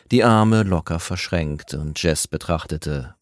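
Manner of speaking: ordinary delivery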